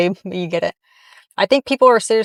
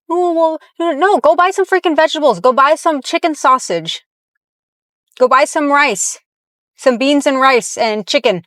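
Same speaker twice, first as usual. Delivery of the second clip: high-pitched fed-up lecturing, faster; exasperated rant; 6 semitones higher than usual. Acted. Vlogger scolding the mom in a heated, higher rant. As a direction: push the orders out, worked up and higher.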